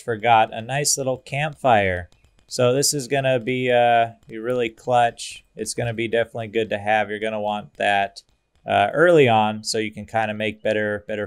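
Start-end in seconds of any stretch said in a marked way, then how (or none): none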